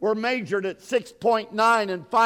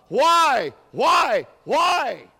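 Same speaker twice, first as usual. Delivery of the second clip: higher, loud shouted Why; 5 semitones higher, 4 dB louder, much slower than usual. Acted. Preacher at the climax, shouting Why at the church. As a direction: cry out Why three times.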